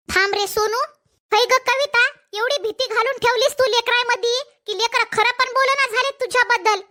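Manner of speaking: normal talking voice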